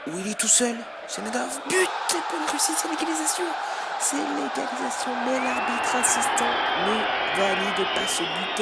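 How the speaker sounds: high, loud equaliser call; excited sports commentary. Real, not acted. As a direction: lift to a shout. (commentaire de but enflammé)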